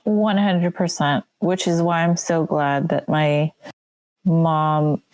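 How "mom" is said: usual speaking voice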